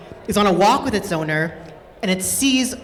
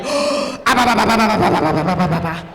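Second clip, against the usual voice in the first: mock greedy gobbling. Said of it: "they're just like".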